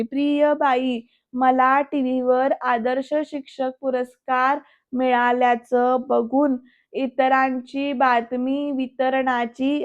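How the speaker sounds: slow, word-by-word schoolchild reading; mistaken reading aloud. Retold or imitated. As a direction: imitate a pupil laboring through the text and garbling it. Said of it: "असं काहीतरी वेगळं वेगळं बोलते".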